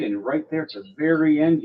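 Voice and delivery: ordinary talk, nothing marked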